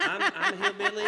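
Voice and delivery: high, loud laugh through the words; cracking up at himself. Real, not acted. Laughing at his own hillbilly joke, loud and high.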